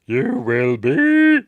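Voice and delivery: loud, high, very drawn-out creature voice; mock botched take. Imitating Frank Oz's failed take as Yoda, loud exaggerated. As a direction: overblown slow squawk, stretched last word. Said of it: "Took a while for him to get that right ... Kept saying".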